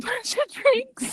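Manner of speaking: breaking into a laugh on "bunch". Real, not acted. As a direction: giggle through the line, pitch jumping up.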